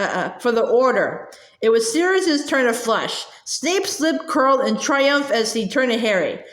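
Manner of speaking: ordinary delivery, nothing marked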